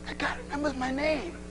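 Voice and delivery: hushed, impressed murmur; hushed amazement. Acted. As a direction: act out quietly marvelling to yourself. Acting person amazed at being remembered.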